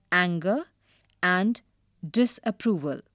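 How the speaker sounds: ordinary delivery, nothing marked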